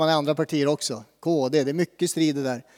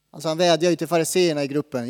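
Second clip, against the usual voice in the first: normal talking voice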